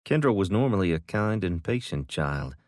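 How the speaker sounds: usual speaking voice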